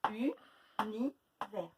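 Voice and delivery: univers very slowly, syllable by syllable; drawn-out word. Shown as a demonstration. Teacher to pupils, drawing out each syllable. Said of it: "U, il est ici".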